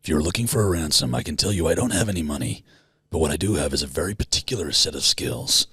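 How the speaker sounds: low, measured menace; film avenger's threat. Acted. Father to his daughter's kidnapper, low menacing threat. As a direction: quietly spell out a threat to a kidnapper. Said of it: "what's his speech?".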